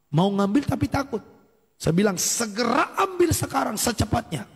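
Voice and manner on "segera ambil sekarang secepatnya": insistent high-pitched order, re-enacted; acted command, first word stretched high; urgent command. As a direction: press them to act at once.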